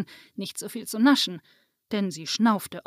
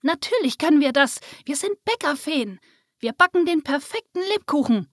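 higher and louder, offended cries; indignant protest; 6 semitones higher, 4 dB louder than usual. A narrator's reading. Fairies shouting back at Waltrudes, indignant and loud. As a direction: protest as if insulted. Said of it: "schimpften die Feen um sie herum empört".

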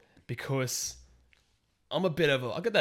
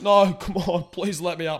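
pleading dad; 6 semitones higher, 9 dB louder than usual. Retold or imitated. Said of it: "And he's like".

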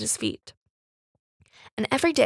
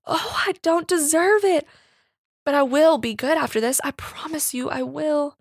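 high, loud tearful cry; sobbing; 4 semitones higher, 4 dB louder than usual. A narrator's reading. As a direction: cry out through sobs. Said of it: "a sob that ended in a loud cry".

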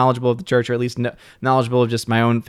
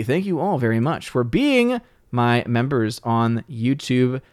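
normal talking voice

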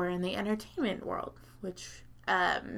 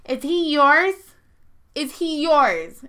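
high, loud, insistent questioning; pushy stranger; 6 semitones higher, 9 dB louder, slower than usual. Retold or imitated. Sparkly visor lady asks the narrator, high insistent demand. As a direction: demand an answer like a territorial stranger.

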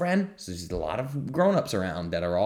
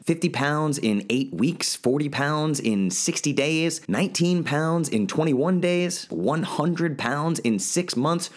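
higher pitch, building excitement; hyped-up listing; 7 semitones higher, slower than usual. Acted. Host touting client results to listeners, excited pitch. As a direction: sell every result like a big win.

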